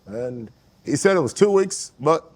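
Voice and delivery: halting, measured presidential impersonation; Barack Obama. Acted. Correcting the host about a story, Obama impression. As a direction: pause and hedge like the mimicked president. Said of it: "But then you won't do your Barack".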